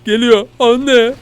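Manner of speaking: high, loud panicked cry for mom; hammed-up scared shout; mock panic. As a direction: yelp like something is coming for you. Panic as monster grows.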